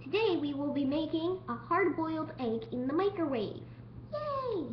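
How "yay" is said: usual speaking voice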